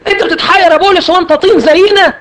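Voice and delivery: صوت أعلى بكثير باستغراب ساخر؛ اندهاش مسرحي متهكم؛ سائل ساخر يستغرب اكتئاب بولس بصوت عالٍ; mock disbelief.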